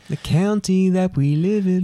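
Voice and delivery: high, slow twangy sung echo; mock country singer. Son echoing dad like a country and western song. Mocking echo of dad's phrase.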